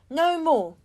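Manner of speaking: loud headline shout; headline read out. A narrator's reading. Reading a tabloid headline aloud, loud and bold. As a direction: bang it out like a tabloid front page.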